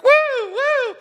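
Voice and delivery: a very high, loud squeal of delight; mock excitement at a small check; mock cheering. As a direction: squeal it like a tiny windfall. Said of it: "we're like".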